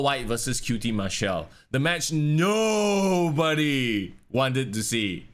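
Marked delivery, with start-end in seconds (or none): 1.7-5.3 "nobody" stretched long on a held note; hugely elongated "nobody", very slow; drawn-out NOBODY. Real, not acted. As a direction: drag out "nobody" for mocking emphasis. Mocking the unwanted match just announced.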